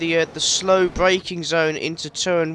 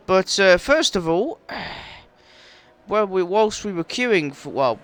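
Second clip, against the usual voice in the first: ordinary talk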